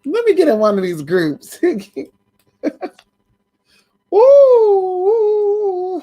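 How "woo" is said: high, loud whoop of glee; real excited woo. Real, not acted. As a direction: let out a giddy woo. Cheering at the news.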